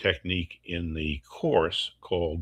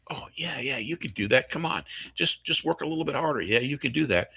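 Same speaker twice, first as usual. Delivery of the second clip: mock eager encouragement pitched much higher; pushy cheerleading; 7 semitones higher than usual. Adult talks student out of doubt, mock high cheerful push. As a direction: brush off the doubt with hammed-up cheer.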